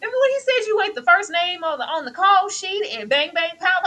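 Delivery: ordinary talk, nothing marked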